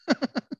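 brief high chuckle; real little laugh; amused laugh. Real, not acted. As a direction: chuckle at your own remark. Amused by restless person.

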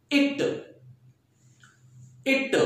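unhurried spelling-test delivery; slow dictation. Shown as a demonstration. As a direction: say the word slowly and clearly for writing. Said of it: "मैं धीरे धीरे बोल रहा हूँ".

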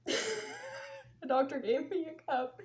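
soft, slow words squeezed through laughter; laughing. Real, not acted. Cracking up telling the doctor story, laughing. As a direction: repeat it while fighting the giggles. Cracks up repeating her line.

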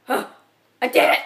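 loud, high cheer of victory; triumphant shout. Real, not acted. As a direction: yell it out in triumph. Triumph after making the jump.

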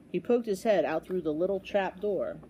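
ordinary delivery, nothing marked

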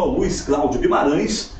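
normal talking voice

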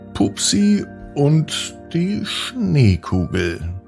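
ordinary delivery

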